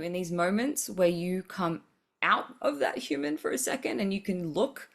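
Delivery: normal talking voice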